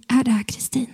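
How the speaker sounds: hushed, trembling phone voice. Retold or imitated. Trembling caller asking for Kristin, hushed and unsteady. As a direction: say it softly, as if shaken. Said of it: "en darrig röst på andra sidan säger".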